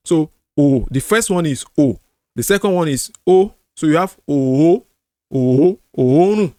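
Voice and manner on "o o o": O drawn out as two Os; elongated vowel. Shown as a demonstration. Teacher showing what the mark stands for, elongated O. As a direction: hold the O long, moving in tone. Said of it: "that stands for two O's".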